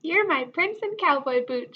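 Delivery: joyful shout. A narrator's reading. Miss Lurleen finding her cowboy, joyful shout. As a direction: announce it with joy. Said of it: "shouted Miss Lurleen".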